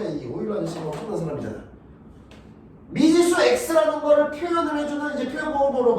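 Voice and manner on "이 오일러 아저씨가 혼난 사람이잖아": quick low aside. Shown as a demonstration.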